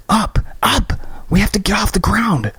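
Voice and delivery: high, pressing urgent order; urgent shout. Acted. Christy shouting at Graham to get off the ground, urgent. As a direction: drive him upward.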